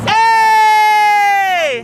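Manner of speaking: high loud call; shout. Real, not acted. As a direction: yell it out. Calling out at a man.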